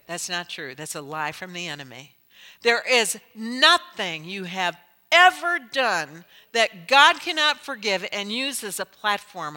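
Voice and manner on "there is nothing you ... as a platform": loud, slow, high preacher call; fervent preaching. Real, not acted. Preacher assuring congregation of forgiveness, loud fervent sermon. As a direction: proclaim it to the whole room.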